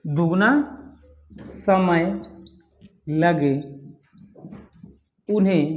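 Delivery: slow, word-by-word dictation with long pauses. Shown as a demonstration. Teacher dictating a definition to students, slow dictation.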